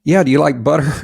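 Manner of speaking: loud, high imitation of a non-whisperer; failed whisper. Date asks about butter during the movie, too loud. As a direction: blurt it out far too loud for a cinema.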